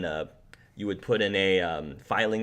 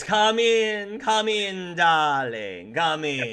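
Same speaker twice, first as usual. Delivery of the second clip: higher, airy fortune teller beckoning; woman's voice; 6 semitones higher, 3 dB louder, slower than usual. Acted. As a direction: coax him in like a mystic hostess. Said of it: "you hear a woman's voice".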